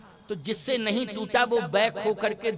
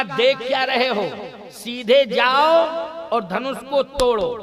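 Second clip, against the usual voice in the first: impatient command; 4 dB louder than usual. Acted. Janak to the idle kings, impatient loud command. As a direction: order them forward, stretching the loud words.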